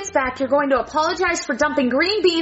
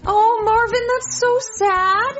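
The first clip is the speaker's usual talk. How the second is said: higher, drawn-out lament; saddened sympathy; 6 semitones higher, 3 dB louder, slower than usual. Acted. Partner reacting to a painter's death, mournful cooing. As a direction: stretch it out in pity, pitched up.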